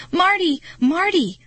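high, loud frantic cries; screaming a name. A narrator's reading. Girl screaming to fallen Marty, frantic. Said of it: "I screamed his name again and again".

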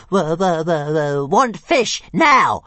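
high, loud, stuttering demand; shrill, demanding creature voice; hissing dragon. A narrator's reading. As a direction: stammer then burst out as an insistent little dragon. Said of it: "hissed Toothless".

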